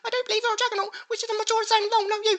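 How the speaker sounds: hammed-up whiny commenter with a gasp at the horror; squeaky mocking voice.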